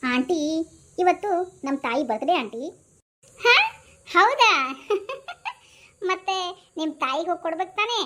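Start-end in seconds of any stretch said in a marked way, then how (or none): 3.4-6.1 laughing. Acted. Aunt amused by birthday claim.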